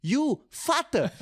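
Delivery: very high, loud Sino-Cambodian mum impression; comic high, loud punchline in a Cambodian-Chinese accent; mother's Sino-Cambodian accent. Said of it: "avec l'accent, c'est bonus".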